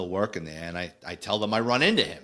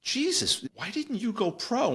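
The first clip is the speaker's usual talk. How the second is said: much higher, astonished exclamation; amazed; 12 semitones higher than usual. Retold or imitated. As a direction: blurt it out, blown away. Amazed at colleague's arm.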